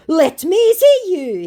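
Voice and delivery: high, loud, drawn-out order; mock demand. Scammer demanding to see the host's face, mock insistent. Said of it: "he demands, with three exclamation points".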